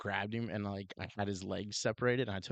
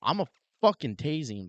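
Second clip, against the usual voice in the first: heated retort; 5 semitones higher, 6 dB louder than usual. Retold or imitated.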